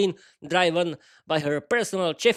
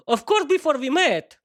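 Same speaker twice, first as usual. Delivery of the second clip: fearful reply; 9 semitones higher, 5 dB louder than usual. Acted. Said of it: "replied fearfully".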